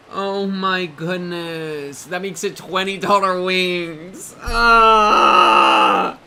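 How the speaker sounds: slow, drawn-out dismay; dismayed groan. Real, not acted. Streamer to chat, slowly groaning about pricey wings. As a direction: let the words sag in despair.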